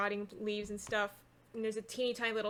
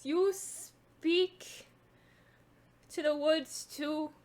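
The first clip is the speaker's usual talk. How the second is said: higher-pitched, halting crone's question; crazed old woman; 5 semitones higher, much slower than usual. Acted. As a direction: ask it as a strange old hag.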